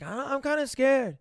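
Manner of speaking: high, small mock-scared voice; scared little girl. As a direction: imitate a frightened kid. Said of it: "She's like".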